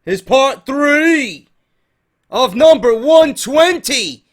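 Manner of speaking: loud, slow announcer-style opener; big stretched-out hype intro voice; hyped show intro. Acted. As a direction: boom it out like a show host. Hyped vlog intro over music.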